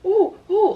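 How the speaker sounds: high imitated yelps of pain; pained ooh. Patient clenching as doctor pulls stitches, imitated pained oohs. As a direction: wince out two high hoots.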